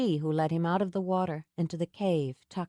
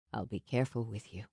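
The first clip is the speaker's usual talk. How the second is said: lower, softer, quick and hushed; low soft promise; 8 semitones lower, 7 dB quieter, faster than usual. A narrator's reading. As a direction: reassure her, low and close. Sam promising gentleness.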